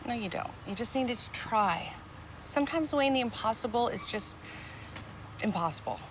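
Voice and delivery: tender. Acted. Wife gently consoling husband.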